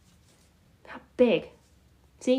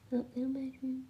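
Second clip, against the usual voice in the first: much softer, a murmured alien coo; hushed alien murmur; 2 semitones higher, 10 dB quieter, faster than usual. Pretend. Cooing at the little mushroom on stream, a much softer hushed alien voice.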